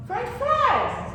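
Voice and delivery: high, loud burst on French fries; eager craving. Shown as a demonstration. Teacher voicing a hungry student's wish, high and loud. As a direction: blurt it out like a sudden craving.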